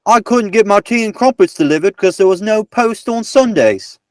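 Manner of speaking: high, hammed-up British voice; mock British accent. Gives clue sentence as mock Briton.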